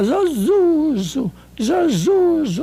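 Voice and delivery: imitating a speech-impaired friend saying Zozuzo; imitating slurred speech. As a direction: stretch Zozuzo out like a tongue that won't obey.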